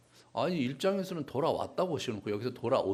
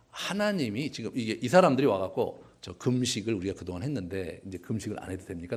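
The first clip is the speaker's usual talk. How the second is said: ordinary talk, nothing marked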